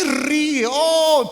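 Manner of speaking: loud, slow, gleeful whoop; mock jubilation. Husband cheering as the mother-in-law leaves, loud and gleeful. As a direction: celebrate like a freed man.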